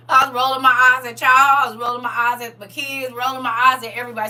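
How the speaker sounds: loud, high, laughing confession; laughing shout. Real, not acted. Confessing to viewers, loud laughing outburst.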